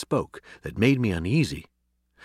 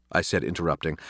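plain reading voice

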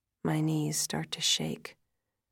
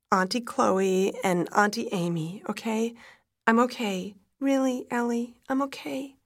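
raised, strained reassurance, climbing higher; distraught reassurance; 6 semitones higher, 5 dB louder than usual. A narrator's reading. Mother reassuring crying daughter before calling family, distraught. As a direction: insist you're okay while barely coping.

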